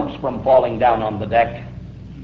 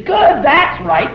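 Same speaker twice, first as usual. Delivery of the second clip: higher, slow, shouted praise; cheering parent; 10 semitones higher, slower than usual. Acted. Parent cheering baby's first step, excited shout. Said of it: "and you say".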